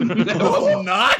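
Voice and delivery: loud, high, drawn-out incredulous question; laughing disbelief. Real, not acted. Game master reacting to a player's absurd boast, laughing disbelief. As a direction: blurt it out loudly through laughter.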